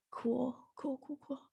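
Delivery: trailing off into a sarcastic cool cool; deadpan cool cool. Retold or imitated. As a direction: murmur it, checked out and done. Deadpan self-quote at dinner.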